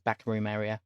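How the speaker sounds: usual speaking voice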